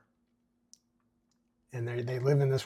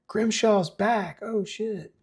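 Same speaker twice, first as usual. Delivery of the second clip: high-pitched panic; 9 semitones higher, slower than usual. Acted. Teens panic.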